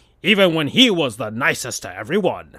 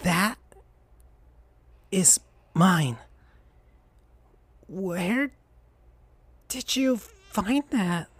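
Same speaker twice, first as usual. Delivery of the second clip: weak and tired; 5 semitones higher, 6 dB quieter, slower than usual. Acted. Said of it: "looking confused and tired".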